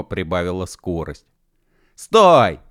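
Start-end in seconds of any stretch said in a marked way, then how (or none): 1.9-2.6 high, very loud shout; yell. A narrator's reading. Mashkov after the speeding platform, high full yell. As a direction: bellow to halt the platform. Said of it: "заорал Машков".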